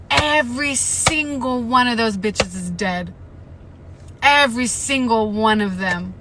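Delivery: loud, slow, stretched-out dismay; loud horrified groaning. Real, not acted. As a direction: drag it out, loud and appalled. Reacting to the massacre.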